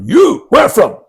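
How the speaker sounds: high, loud clipped mock question; loud high imitation of a gruff Japanese sensei; imitating Japanese teacher. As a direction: bark a short foreign question, mock. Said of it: "this guy goes".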